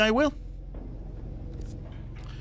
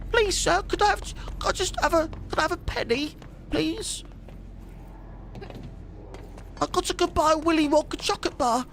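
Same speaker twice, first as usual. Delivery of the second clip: put-on much higher, pleading little urchin with a British accent; Victorian chimney sweep; 10 semitones higher, 3 dB quieter than usual. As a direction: beg for a penny like a Cockney street kid. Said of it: "Victorian chimney sweep, yeah".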